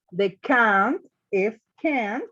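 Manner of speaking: slow, clear model of "can't"; slow teacher demo of "can't"; teacher modelling pace. Shown as a demonstration. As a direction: spell out "can't" slowly for the class. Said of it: "teacher models the negative form".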